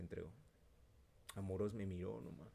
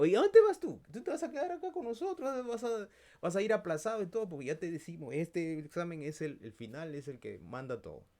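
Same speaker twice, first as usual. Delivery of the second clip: much higher, loud teasing voice; mocking friends; 9 semitones higher, 9 dB louder than usual. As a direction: jeer at him like classmates. Amigos se burlan de él. (amigos burlones con voz aguda)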